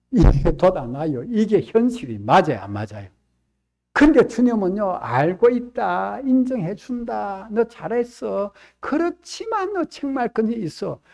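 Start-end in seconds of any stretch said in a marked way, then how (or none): 5.0-11.0 voice of the Lord. Retold or imitated. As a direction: speak as the Lord judging gently. Voicing the Lord's verdict.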